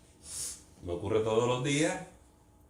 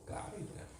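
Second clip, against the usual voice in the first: whispered reply; 8 semitones higher, 15 dB quieter, much faster than usual. Retold or imitated. Peter answers Jesus in retelling.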